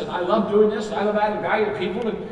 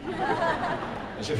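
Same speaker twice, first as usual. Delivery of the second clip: usual speaking voice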